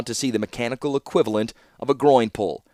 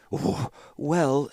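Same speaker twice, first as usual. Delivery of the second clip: soft, halting stammer; trembling; 4 semitones higher, 4 dB quieter than usual. A narrator's reading. Nervous Seneschal facing the raging warlord, trembling. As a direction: quaver with nerves. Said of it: "said the trembling Seneschal".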